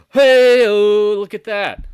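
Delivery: very high, loud, drawn-out whoop; stretched falsetto cry, easing down at the end; mock amazement. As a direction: holler it in hammed-up wonder. Acts out his amazed reaction.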